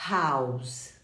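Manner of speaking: "house" with a clear breathy H, falling low; H pronounced. Shown as a demonstration. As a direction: sound the H at the start of "house". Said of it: "o H é pronunciado".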